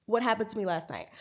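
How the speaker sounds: ordinary delivery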